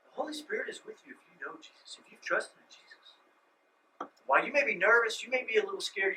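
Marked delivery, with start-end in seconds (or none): 0.1-3.2 hushed, reassuring whisper. Acted. Pastor whispering assurance to nervous believers. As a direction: whisper the comfort close.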